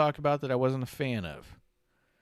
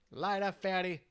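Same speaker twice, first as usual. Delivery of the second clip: imitating the Godfather; 6 semitones higher, 6 dB quieter than usual. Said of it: "as they say".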